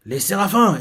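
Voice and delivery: retold shout at the seraphim; mock shout. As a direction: call out the name as if shouting. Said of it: "Elle a crié dessus".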